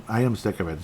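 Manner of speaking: normal talking voice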